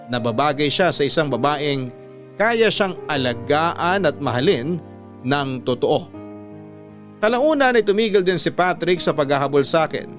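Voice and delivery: ordinary delivery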